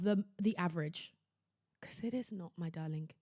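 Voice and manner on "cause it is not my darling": softer, lower, consoling "my darling"; tender reassurance. Real, not acted. Tender reassurance to teen viewers.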